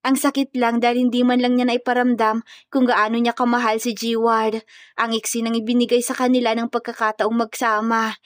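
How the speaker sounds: ordinary delivery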